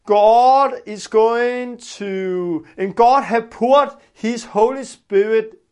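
ordinary talk

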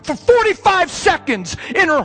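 high, loud anguished preaching; full-force preacher's plea; impassioned preaching. Real, not acted. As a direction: cry it out to the congregation.

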